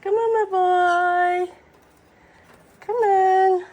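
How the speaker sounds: loud coaxing call. Real, not acted. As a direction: coax the bird over, loud. Loud calling to pets.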